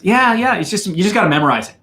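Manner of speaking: normal talking voice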